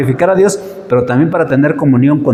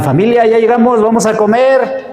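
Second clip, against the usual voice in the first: calling out; 8 semitones higher than usual. Retold or imitated. Calling family on arriving home.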